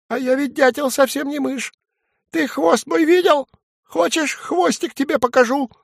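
a cheeky little dormouse pleading its case; tiny dormouse squeaking at the woodpecker; high dormouse voice. Acted. Dormouse character voice.

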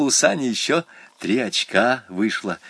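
usual speaking voice

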